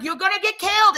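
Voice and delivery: high, loud panicked warning; yelling at them to stop; alarmed yell. Retold or imitated. Warning friends fighting on highway, panicked yell. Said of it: "and I'm like".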